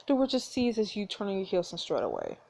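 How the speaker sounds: normal talking voice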